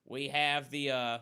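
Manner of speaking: put-on loud, hammy Australian accent. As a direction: announce it like a bad Aussie. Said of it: "These are the worst accents you'll ever hear".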